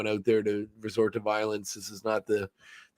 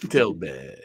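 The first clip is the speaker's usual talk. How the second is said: Matt Berry impression; 6 semitones lower than usual. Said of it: "sounds like him doing this voice".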